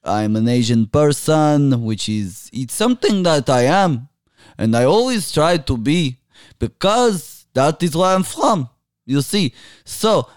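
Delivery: put-on Middle Eastern accent, lilting pitch. Guest greeting podcast listeners on request, put-on Middle Eastern accent.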